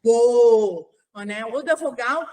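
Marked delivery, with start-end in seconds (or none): 0.0-0.9 bo repeated loud and clear; isolated syllable bo. Shown as a demonstration. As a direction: say bo cleanly, a lone syllable. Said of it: "sonzinho da sílaba".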